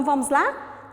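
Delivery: ordinary talk, nothing marked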